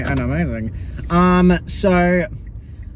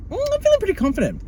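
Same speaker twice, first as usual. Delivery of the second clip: much higher, fast, breezy mock of a smug girl; mock smug classmate; much faster than usual. Mocking the calm classmate before the assessment, high and fast. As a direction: toss it off like nothing bothers you. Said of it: "She's like".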